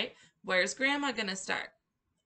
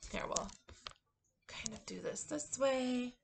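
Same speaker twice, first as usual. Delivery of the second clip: whispered aside; 2 semitones higher, 7 dB quieter than usual. Real, not acted. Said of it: "aside off-mic whisper".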